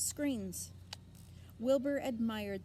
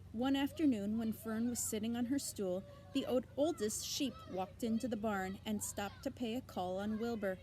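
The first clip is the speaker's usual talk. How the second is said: ordinary delivery, nothing marked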